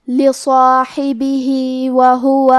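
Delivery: لصاحبہ وہو recited slowly, with the final vowel lengthened; madd demo. Shown as a demonstration. Teacher demonstrates the short madd, slow chant. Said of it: "مد صلہ صغرا کو ہمیں ظاہر کرنا".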